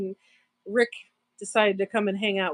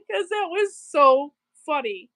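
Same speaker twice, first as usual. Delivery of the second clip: higher voice breaking into real laughter; laughing hard; 6 semitones higher, 4 dB louder than usual. Real, not acted. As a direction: say it while still cracking up. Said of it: "I'm laughing so hard".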